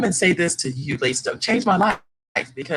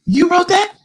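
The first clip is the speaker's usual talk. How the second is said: astonished question; 13 semitones higher, 7 dB louder than usual. Retold or imitated. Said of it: "she goes".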